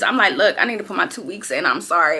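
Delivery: ordinary delivery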